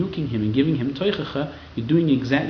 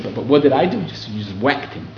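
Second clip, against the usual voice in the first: ordinary talk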